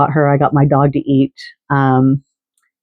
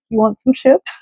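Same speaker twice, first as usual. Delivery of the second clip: composed sweet voice; 8 semitones higher than usual. Retold or imitated. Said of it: "she's like".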